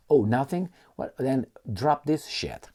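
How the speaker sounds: ordinary delivery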